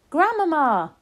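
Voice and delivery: high, full-out call; loud shout. A narrator's reading. Child calling through grandmother's door, shouting. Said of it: "I shouted as loudly as I possibly could".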